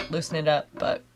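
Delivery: ordinary delivery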